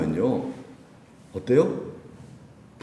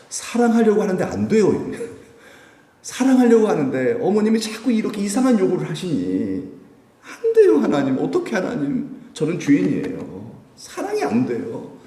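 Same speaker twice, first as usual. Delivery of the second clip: acted high-pitched, anguished plea to God; loud, pleading lament, pitched way up; tearful confession; 10 semitones higher, 5 dB louder than usual. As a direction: cry out helplessly, as if confessing in tears. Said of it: "눈물로 하나님께 자백을".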